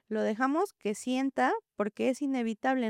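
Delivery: ordinary delivery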